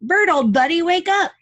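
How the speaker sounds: high, loud cheerful wake-up call; Ernie calling out. Acted. Ernie waking sleepy Bert, high cheerful call. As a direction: bounce it out to rouse Bert. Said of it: "Ernie said".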